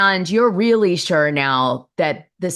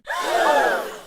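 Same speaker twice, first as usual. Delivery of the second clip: high sharp gasp of shock. Real, not acted. Podcast host gasps at the guest's shocking story. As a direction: gasp at the awful news.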